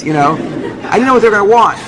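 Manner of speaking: plain reading voice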